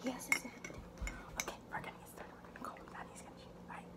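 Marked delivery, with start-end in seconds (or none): 1.4-3.9 hushed whisper. Real, not acted. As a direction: whisper the plan. Whispering before calling brother.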